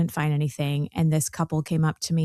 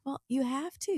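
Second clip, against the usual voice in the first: light Southern lilt, higher; Southern accent; 8 semitones higher, 7 dB quieter than usual. Retold or imitated. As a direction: answer the kids with a hint of the South. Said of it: "you have your Southern on".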